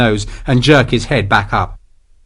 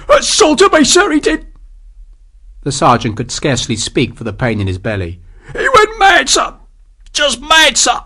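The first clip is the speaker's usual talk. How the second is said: high, strained complaining soldier; pained sergeant; 14 semitones higher than usual. Acted. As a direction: gasp it out through the pain.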